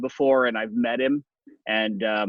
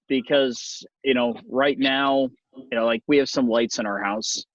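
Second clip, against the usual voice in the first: normal talking voice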